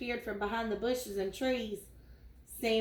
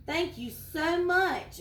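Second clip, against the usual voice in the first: higher-pitched, drawn-out thanks; grateful exclamation; 6 semitones higher, slower than usual. Acted. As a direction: exclaim it slowly, stretching the gratitude. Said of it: "exclaimed Sammy".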